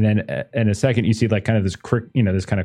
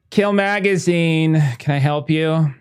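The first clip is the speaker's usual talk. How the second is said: a light, higher receptionist answering the phone; 5 semitones higher, 4 dB louder than usual. Acted. Receptionist answering a caller on the line, perky.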